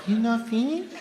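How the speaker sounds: put-on high, light accented question; subtle Japanese accent. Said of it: "No, it was subtle".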